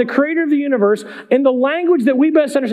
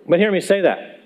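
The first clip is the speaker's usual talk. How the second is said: ordinary delivery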